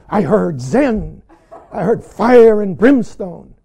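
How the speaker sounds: loud, slow, hammed-up boast; mock triumphant proclamation. Student stomping out wanting something magical, mock triumphant proclamation. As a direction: proclaim it with fireworks on the key words.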